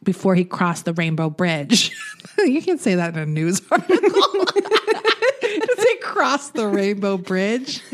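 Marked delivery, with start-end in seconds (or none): 4.6-7.8 high, drawn-out mock incredulity through laughter; laughing mockery. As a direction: stretch it out, laughing at the flowery phrase. Mocking the article's flowery wording.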